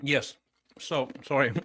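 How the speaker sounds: chewing ice while talking; mouth full of ice. Real, not acted. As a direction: talk around a mouthful of ice. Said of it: "chewing on ice".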